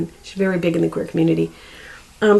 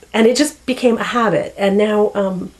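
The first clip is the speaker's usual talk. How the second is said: normal talking voice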